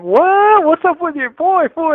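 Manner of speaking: high, loud amused "what?"; surprised. Retold or imitated. Prison homies asking about the dancing toddler, high amused surprise. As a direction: react like the homies seeing a tiny rapper. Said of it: "they will be like".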